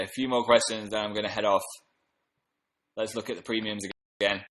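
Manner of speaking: ordinary delivery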